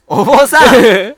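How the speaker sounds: loud drawn-out call. Real, not acted. 消えたお坊さんを呼ぶ. (消えたお坊さんを大声で長く呼ぶ)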